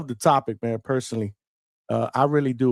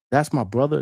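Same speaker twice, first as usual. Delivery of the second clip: normal talking voice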